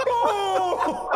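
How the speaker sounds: high wailing cry; mock howl, stretched out; imitated dog howl. Owner imitating her abandoned dog, howled wail. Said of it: "literally sounded like her going".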